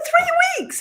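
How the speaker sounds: shocked it came so soon; surprised disbelief. Retold or imitated. Jack reliving the quick approval, incredulous.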